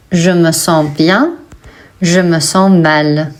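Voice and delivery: ordinary talk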